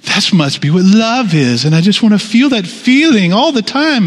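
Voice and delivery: a hint of his starry-eyed younger self; lovestruck young self. Pastor mimics his lovesick young adult self, lightly hammed. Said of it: "I'm like".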